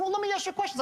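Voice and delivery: very high-pitched, disarming lead-in; money-aside tone. Shown as a demonstration. Sales trainer shows how to imply money aside, high and disarming. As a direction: float it up high, brushing the objection aside. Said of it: "I push the money aside with my tone of voice".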